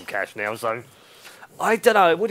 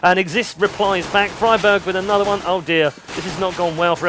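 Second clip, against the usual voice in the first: louder, higher caster call; play-by-play; 4 semitones higher, 5 dB louder than usual. Acted. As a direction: call the action live.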